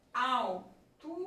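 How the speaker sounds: "Altura" broken slowly into al, tu, ra; "Altura" in slow, detached syllables; split into syllables. Shown as a demonstration. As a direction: separate each syllable of "Altura" with a gap. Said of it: "O L com o T acontece em sílabas diferentes".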